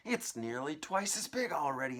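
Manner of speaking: high, loud cry; shouted. Acted. Aunt shouting as the peach swells. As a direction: shout it in amazement. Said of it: "Aunt Spiker shouted".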